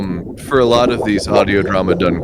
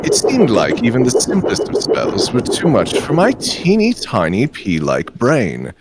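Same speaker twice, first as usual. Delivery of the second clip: put-on posh British accent; 6 semitones higher than usual. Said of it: "the exceedingly posh accent that".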